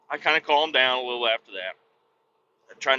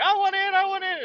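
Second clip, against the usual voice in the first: put-on high, whining little kid; lightly mocked kids pleading; whiny kid. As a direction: beg like an impatient child. Kids begging.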